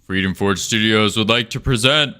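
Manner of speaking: booming showbiz announcer. Acted. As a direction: present it like a big stage host. Grand announcer intro over music.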